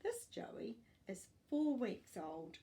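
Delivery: usual speaking voice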